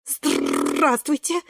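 stuttering, shivering greeting; teeth chattering. A narrator's reading. Брусничка freezing in the river, shivery stutter. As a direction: stretch it out through chattering teeth. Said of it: "зуб на зуб не попадает".

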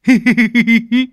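high, loud rapid giggle; gleeful giggle. Acted. Tý delighted at finding ripe mangoes, gleeful high giggle.

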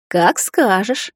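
slow, drawn-out gloating; smug, lingering compliance; grinning menace. A narrator's reading. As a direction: agree slowly with a predatory grin. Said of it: "оскалившись, улыбнулась Эстера".

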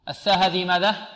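ordinary delivery

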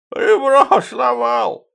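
very high, slow lisping boast; boasting Fima. Acted. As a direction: crow it proudly in a squeaky lisp. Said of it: "похвастался Фима".

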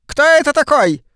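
high, loud shriek. A narrator's reading. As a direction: shriek like a startled old woman. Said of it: "завизжала миссис Шефер". (завизжала)

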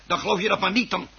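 hoger en harder, opgewonden; geïrriteerd uitroepen. Acted. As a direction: laat je ergernis opvlammen. Man wordt kwaad. (man roept geïrriteerd naar zijn ongelovige vrouw)

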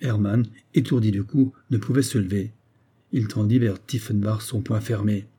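usual speaking voice

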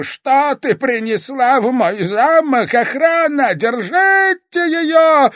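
very high, loud, drawn-out screams; frantic yelling. A narrator's reading. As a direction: scream for the guards. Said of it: "Старик истошно завопил".

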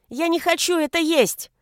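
put-on high, loud little-boy protest; child's defiant yell. A narrator's reading. Son refusing food before father, yelling. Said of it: "и закричал".

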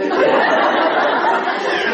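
a real breathy burst of laughter. Real, not acted. Monk laughing at the daughter's mock lament, breathy laugh.

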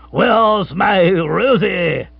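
high, very slow, drawn-out call; booming, sing-out happy character voice; jubilant captain. Acted. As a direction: call out lovingly with hearty gusto. Captain bustles in humming happily.

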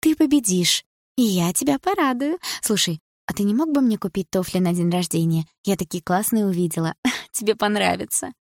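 put-on high, girlish wheedling voice; coaxing girlfriend. A narrator's reading. Girlfriend asking the racer for birthday shoes, coaxing.

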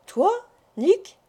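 startled, rising disbelief; surprised cry. A narrator's reading. Koltz astonished that Nick volunteers, surprised cry. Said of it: "s'écria maître Koltz".

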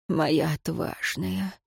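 slow, quiet tender murmur. A narrator's reading. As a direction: say it softly with a smile. He smiles at her.

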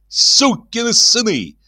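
high, slow indignant cry; blustering outrage. A narrator's reading. Locksmith blusters to the crowd, indignant. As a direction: bluster to the onlookers. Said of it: "говорил он, обращаясь к зрителям".

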